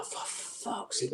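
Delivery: slow whispered curse under breath; slow whispered frustration; muttered whisper. Retold or imitated. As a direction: hiss it to yourself, exasperated. Searching screens for game.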